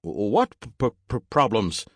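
high stuttering robot; robot speech impediment. Acted. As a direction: get stuck on the consonants like a glitching machine. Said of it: "fix your goddamn speech impediment".

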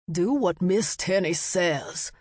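slow, stern order; mildly hollered command in a put-on man's voice; hollering merchant. A narrator's reading. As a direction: order unruly boys to obey. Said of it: "hollered at the boys".

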